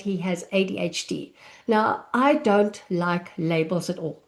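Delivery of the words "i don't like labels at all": ordinary talk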